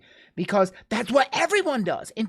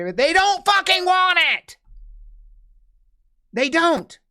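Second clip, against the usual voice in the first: higher, louder furious yell; angry outburst; 10 semitones higher, 4 dB louder than usual. Real, not acted. Ranting to listeners about foreign aid, real angry shout. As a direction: explode with real frustration.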